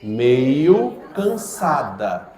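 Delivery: "meio cansada" stretched very slowly; slow, spaced demo. Shown as a demonstration. Teacher modelling the correct phrase for the class.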